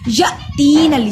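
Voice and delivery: या cut short with a catch before तीन; loud recitation, glottal jolt after या; jerk on hamza. Shown as a demonstration. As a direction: stop या abruptly, then long तीन. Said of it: "या पे झटका देंगे".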